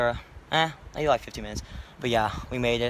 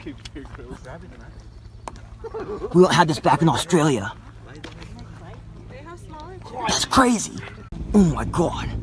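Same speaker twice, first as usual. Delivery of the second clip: hammed-up Australian wildlife presenter; Australian accent; 3 semitones higher than usual. Explorer showing viewers a giant trunk, in a hammed-up Australian accent. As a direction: marvel like an Aussie nature host. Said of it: "Can you speak in an Australian accent".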